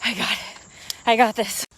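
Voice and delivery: ordinary talk, nothing marked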